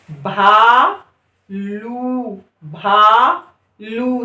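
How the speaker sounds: भालू very slow, लू held long; drawn-out reading. Shown as a demonstration. Teacher repeating भालू slowly for learners. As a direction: sing the ऊ in भालू. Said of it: "तीन चार बार पढ़िए".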